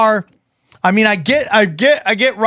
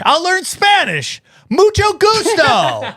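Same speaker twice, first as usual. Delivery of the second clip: mock eager; 8 semitones higher, slower than usual. Mock eager self, Spanish phrase.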